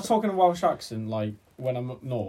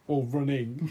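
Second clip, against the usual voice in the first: ordinary talk, nothing marked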